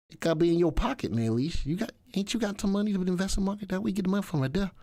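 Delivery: quick, put-on Southern accent. Shown as a demonstration. Host teasing a viewer about finding money, southern accent. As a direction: rattle it off in a playful Southern accent. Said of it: "That's my southern accent".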